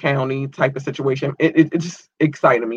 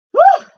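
a very high, loud breathy whew; overwhelmed whew; 7 dB louder than usual. Real, not acted. Host overwhelmed by listeners' love lets out a high whew. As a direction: blow it out high, swept away. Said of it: "I'm just overwhelmed by the love".

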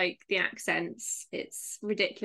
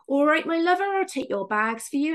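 loud, higher-pitched West Country concierge; Somerset accent; 6 semitones higher, 5 dB louder than usual. Retold or imitated. Bristol concierge greeting a visiting comedian, hammed-up Somerset accent. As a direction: play up a friendly Somerset accent. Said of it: "because we're Somerset".

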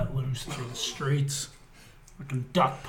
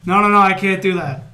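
louder, protesting; mock refusal; 4 semitones higher, 12 dB louder than usual. Hero refusing the alien, louder mock protest. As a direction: protest loudly.

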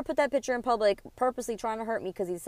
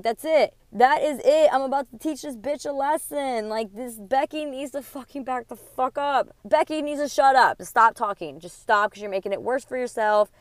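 loud, furious warning to the rival, words hammered out; angry threat; 3 semitones higher, 5 dB louder than usual. Retold or imitated. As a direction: tell her to back off and mean it. Retold threat to rival girlfriend.

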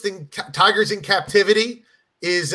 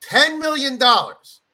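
slow, higher-pitched sales offer; mock big offer; 6 semitones higher than usual. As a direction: draw out the big sum. KFC raises its offer.

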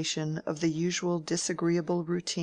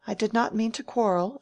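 hurt protest; 6 semitones higher, 4 dB louder than usual. A narrator's reading. Rosamond apologises, hurt.

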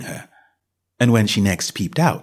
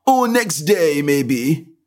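higher, unbothered servant voice; unbothered footman; 5 semitones higher, much slower than usual. A narrator's reading. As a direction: carry on as if nothing happened. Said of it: "continued in the same tone".

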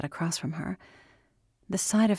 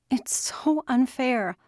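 high, slow, drawn-out complaint; daughter's wailed protest at injustice; anguished protest; 7 semitones higher, slower than usual. Acted. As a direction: stretch SO in a high upset voice. Daughter protests father's situation.

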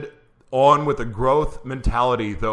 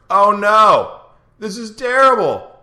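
oh no, higher and louder in real alarm; real alarm, pitched up and louder; loud dismay; 9 semitones higher, 8 dB louder, slower than usual. Real, not acted. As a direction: cry out in dismay as the guest's sound fails. Host dismayed at guest's lost sound.

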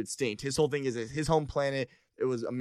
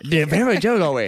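louder, slow put-on accent, echoing the jiggly line; mock Japanese accent, teasing; 3 semitones higher, 10 dB louder than usual. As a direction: ham up the foreign guy's accent. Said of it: "he had, like, an accent".

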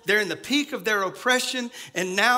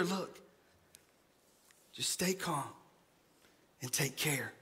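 soft, near-silent soothing; hushed calm; 6 semitones lower, 12 dB quieter than usual. Retold or imitated. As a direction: murmur it to calm them. Calming words of Isaiah.

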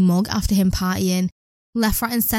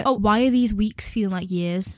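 usual speaking voice

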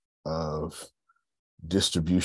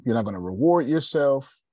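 ordinary talk, nothing marked

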